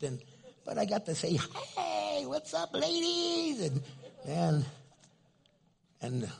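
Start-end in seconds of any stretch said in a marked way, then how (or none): 1.8-3.6 high singsong hello to the room; calling out a greeting. Retold or imitated. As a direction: hail the whole room in a high, drawn-out call. Greets a room full of women.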